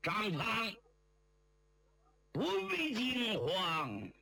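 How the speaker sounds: high, slow, drawn-out call; reassuring call. A narrator's reading. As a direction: call out slowly to steady someone in trouble. Rescuer calls out to Zhang Fang. (拉长声音高声安抚)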